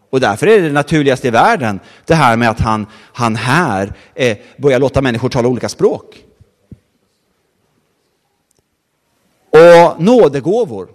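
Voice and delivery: loud, ringing preacher's proclamation; full-force preaching. Acted. Preacher proclaiming to the congregation, loud and ringing. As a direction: preach it out loud to the whole hall.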